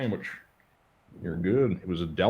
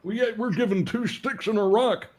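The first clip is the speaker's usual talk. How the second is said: put-on old man voice; 12 semitones higher than usual. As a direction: whine like a cranky grandpa. Old-timer complaint bit.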